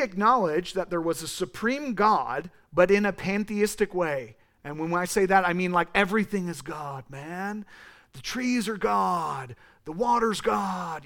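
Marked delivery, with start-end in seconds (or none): 5.7-11.0 slow, drawn-out laid-back California surfer voice; California stoner voice. Shown as a demonstration. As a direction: lean into a lazy West Coast "dude" drawl. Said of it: "I use that voice 'cause I'm from California".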